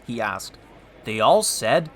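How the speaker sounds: plain reading voice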